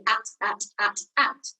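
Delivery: "at" with a clearly released final t; consonant last. Shown as a demonstration. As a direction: drill "at" in separate beats, landing on the t. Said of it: "it's at the end of a word".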